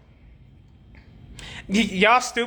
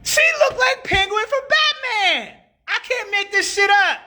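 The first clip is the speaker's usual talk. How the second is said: laughing; 11 semitones higher than usual. Real, not acted. Laughing while describing woman.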